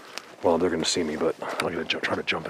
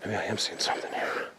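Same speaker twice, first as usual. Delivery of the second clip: whispering; 8 dB quieter than usual. Real, not acted. Spots something in woods.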